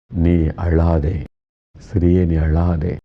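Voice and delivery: slow, gentle consoling words; comforting. Acted. Jesus consoling the weeping widow, slow and tender. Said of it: "ஆறுதலான வார்த்தை சொல்லுகிறார்".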